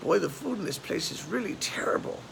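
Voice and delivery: put-on high-pitched complaining old lady; grumbling old lady in a joke; elderly woman. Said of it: "two elderly women".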